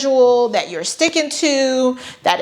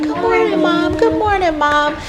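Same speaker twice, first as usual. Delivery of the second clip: put-on much higher, sing-song cheerful children; cheerful kids; 7 semitones higher than usual. Kids greeting mom in her fake perfect morning, high and cheerful. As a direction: chirp it like happy little kids.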